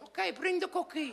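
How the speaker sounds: put-on high, slow accented consent; drawn-out, high-pitched mother voice; resigned mother. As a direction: give in as a weed-seeking immigrant mom. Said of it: "then she went".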